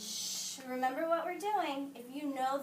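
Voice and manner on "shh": hush. Real, not acted. Hushing a student.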